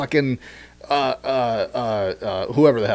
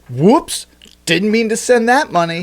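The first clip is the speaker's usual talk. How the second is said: mock innocent; 7 semitones higher, 3 dB louder than usual. Mocking accidental payment.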